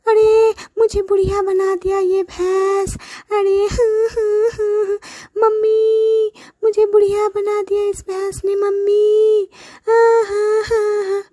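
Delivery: high whimpering wail; wailing old woman. Acted. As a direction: whine to mummy in distress. Sister wails to mother.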